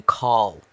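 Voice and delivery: "Call" bent toward "cole"; imitated fake accent. Co-host explaining actor's accent slip, imitating "Call". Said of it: "that was him saying".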